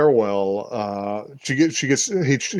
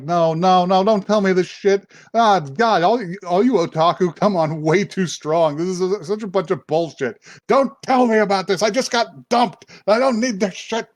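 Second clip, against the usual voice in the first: higher-pitched acted outburst; exasperated rant; 6 semitones higher than usual. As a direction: retell a fed-up tirade, pitch climbing.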